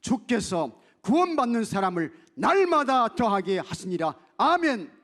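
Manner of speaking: loud, high scripture proclamation; preaching at full force. Acted. Pastor proclaims scripture to the congregation, full-force preaching.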